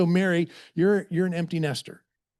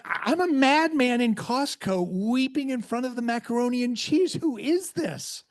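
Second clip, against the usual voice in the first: high-pitched comic distress; hammed-up, incredulous near-wail; mock weeping; 8 semitones higher than usual. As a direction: act out a tearful meltdown in public. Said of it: "You know, I'm bawling".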